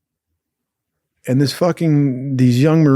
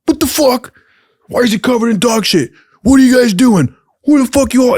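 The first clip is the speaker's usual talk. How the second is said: high, loud mock-outraged boss; furious boss; 12 semitones higher, 4 dB louder than usual. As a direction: yell at the kids like a drunk furious boss. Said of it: "He's like".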